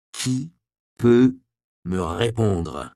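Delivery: chaque mot détaché, lent et menaçant; menace de représailles. Acted. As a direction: promets des représailles sans les dire. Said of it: "d'une voix qui laissait entendre que toute absence de réponse entraînerait de violentes représailles". (Andreas, poings serrés sur le col du moine, d'une voix menaçante)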